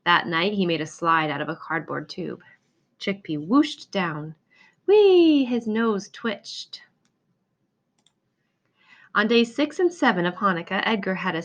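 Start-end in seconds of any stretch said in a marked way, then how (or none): 4.9-5.4 high, loud squeal of delight; gleeful whee. Acted. Hamster whooshing down the cardboard slide, squealing whee.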